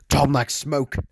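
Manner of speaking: high, sudden call of alarm; alarmed shout. A narrator's reading. Narrator warning Tom about the smoke, shouted. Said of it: "I shouted".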